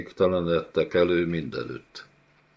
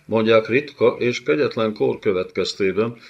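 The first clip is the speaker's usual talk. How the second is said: normal talking voice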